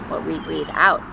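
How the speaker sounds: ordinary talk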